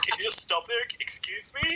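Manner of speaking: imitating the teacher. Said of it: "And she's like".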